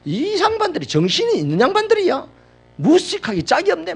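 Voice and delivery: a high, loud, scolding yell at careless neighbors; angry yelling. Retold or imitated. Pastor yells at neighbors smoking into his home, high angry shout. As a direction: bellow it out in retold outrage at the smokers. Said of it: "제가 고함을 질렀습니다".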